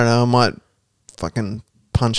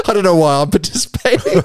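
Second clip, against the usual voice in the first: laughing; 8 semitones higher than usual. Real, not acted. Laughs at own silly idea.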